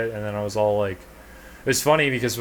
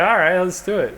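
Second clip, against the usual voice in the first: high, loud and eager; eager agreement; 9 semitones higher, 7 dB louder than usual. Retold or imitated. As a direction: jump in, game for it. Acting out agreeing to smoke.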